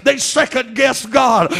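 ordinary talk

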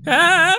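sudden high loud scream; startled scream. Real, not acted. Gamer screams falling down the well. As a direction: yelp as you drop into the well.

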